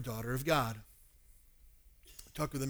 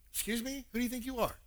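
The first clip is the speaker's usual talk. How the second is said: high, softer indignant retort; mock offended; 7 semitones higher, 5 dB quieter, faster than usual. Scolded churchgoer answering a stranger, offended. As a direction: bristle at a stranger's nerve, acted.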